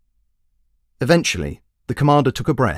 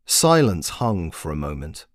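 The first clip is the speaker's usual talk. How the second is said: usual speaking voice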